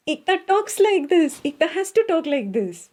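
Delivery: high-pitched, lively Indian way of talking; animated and high, in an Indian manner. Shown as a demonstration. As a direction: demonstrate your natural, excitable Indian delivery. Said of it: "Very Indian way of talking".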